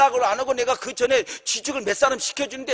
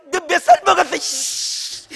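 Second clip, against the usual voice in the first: retold angry cursing back; 7 semitones higher, slower than usual. Pastor finally cursing back at the boss, heated retort. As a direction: lash back at the abuser, re-enacted. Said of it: "열받으니까 나도 막".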